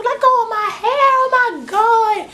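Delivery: very high, drawn-out mock scream; squealed struggle, volume held back; imitated screaming. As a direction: wail it like someone possessed. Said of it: "She is screaming".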